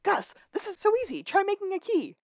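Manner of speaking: high and fast, overexcited; hammed-up eager chatter; giddy boast. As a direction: brag like it's child's play. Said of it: "And he goes".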